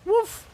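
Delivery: short, high-pitched woof; playful dog woof. Pretend. Streamer remarking on a little dog, playful high woof. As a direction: imitate a little dog's bark, high and quick. Imitates a dog's bark.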